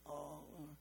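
soft weeping prayer, almost a whisper. Retold or imitated. Friend praying in the night, soft and weeping. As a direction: murmur it like a tearful prayer. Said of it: "gently weeping".

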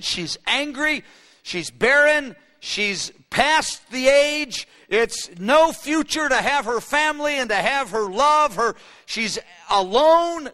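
building preaching. Acted. Preacher painting the barren wife of Isaiah, slow and mounting. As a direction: pile up the images with rising force.

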